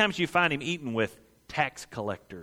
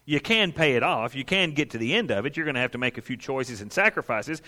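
ordinary talk

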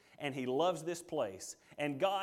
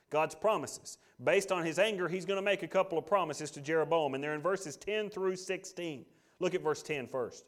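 normal talking voice

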